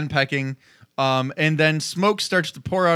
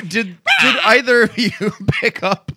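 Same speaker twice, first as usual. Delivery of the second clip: normal talking voice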